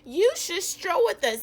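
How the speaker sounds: proud peacocks. Acted. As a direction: call it out like showy peacocks. Peacocks invite Jim.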